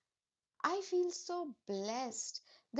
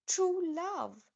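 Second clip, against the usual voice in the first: excited; 6 semitones higher, 5 dB louder than usual. Real, not acted. Announces a card's message.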